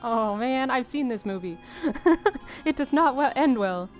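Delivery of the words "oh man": ordinary delivery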